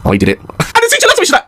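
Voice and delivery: normal talking voice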